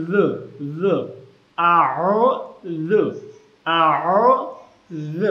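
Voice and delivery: syllable-by-syllable আ, ও, জ in careful Arabic; dhal demo. Shown as a demonstration. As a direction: squeeze ও, soften জ to "dhu". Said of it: "জাল উচ্চারণ করব".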